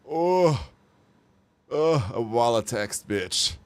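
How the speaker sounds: normal talking voice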